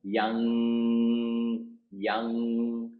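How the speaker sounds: put-on "young" held long on its ringing ng; drawn-out young. Shown as a demonstration. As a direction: stretch "young" into a sustained ng on one note. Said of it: "how you pronounce young".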